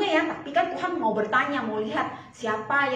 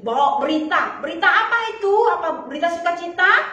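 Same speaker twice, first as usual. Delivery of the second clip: ordinary delivery, nothing marked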